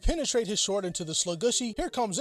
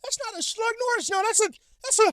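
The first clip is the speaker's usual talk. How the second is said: jumps much higher, shocked and stammering; shocked shriek; 3 dB louder than usual. Acted. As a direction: freak out in a high squeal. Narrator sees the monster.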